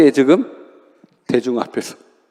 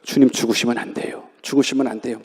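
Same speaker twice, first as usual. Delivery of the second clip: soft pleading Peter; 7 semitones lower, 7 dB quieter than usual. Retold or imitated. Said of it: "베드로 얼마나 ... 사랑합니까?".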